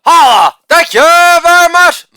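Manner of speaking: loud "Holla", then a very high, loud "thank you very much"; hammed-up falsetto victory call; catchphrase shout. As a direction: belt out the catchphrase like a hype man.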